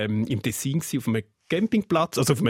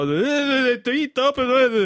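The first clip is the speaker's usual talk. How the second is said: put-on tipsy young guy; 10 semitones higher, 3 dB louder, slower than usual. Drunk youth answering policeman.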